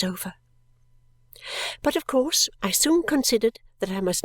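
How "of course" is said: ordinary delivery, nothing marked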